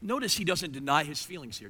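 normal talking voice

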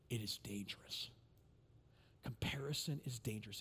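hushed warning whisper; a quiet, unvoiced warning. Real, not acted. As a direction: lean in and whisper it. Preacher drops to warning whisper.

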